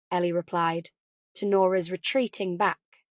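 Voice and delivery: ordinary delivery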